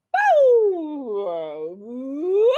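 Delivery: warm-up boo woo. Shown as a demonstration. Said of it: "That sounds like a dog to me".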